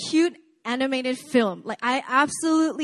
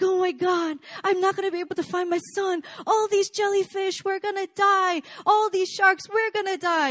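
much higher, fast hammed-up panic; mock meltdown, pitched way up; panicked meltdown; 9 semitones higher than usual. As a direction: play the frantic fish sure they're all gonna die. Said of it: "serious meltdown".

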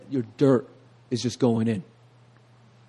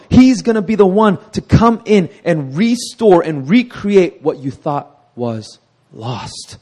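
ordinary talk

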